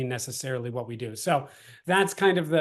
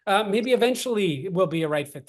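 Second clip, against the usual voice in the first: higher, light reassuring let-down; letting them off gently; 7 semitones higher than usual. Shown as a demonstration. Sales trainer demos kindly letting a prospect go. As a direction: release the customer kindly. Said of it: "Just say".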